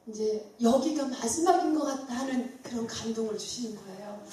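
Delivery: usual speaking voice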